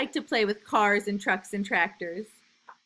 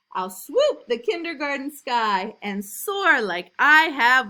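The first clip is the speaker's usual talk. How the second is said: lively, louder storybook reading; reading to children; 5 semitones higher, 8 dB louder, slower than usual. A narrator's reading. Teacher reading a picture book to new kindergartners, lively and loud. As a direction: read it big and bouncy for little kids.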